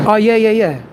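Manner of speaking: usual speaking voice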